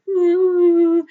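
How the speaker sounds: high, loud crying ooh; a little boy's tearful whimper; child's whimper. Retold or imitated. Boy whimpers in story.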